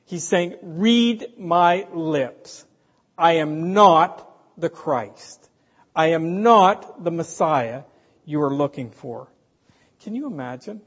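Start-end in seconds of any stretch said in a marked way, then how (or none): 0.6-9.3 very slow, louder, each word weighed; emphatic denial. Retold or imitated. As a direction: spell it out so no one can doubt it. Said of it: "John leaves no wiggle room here".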